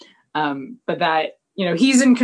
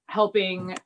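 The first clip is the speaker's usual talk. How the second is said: ordinary delivery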